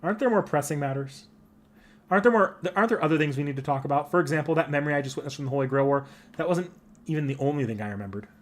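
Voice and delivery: ordinary talk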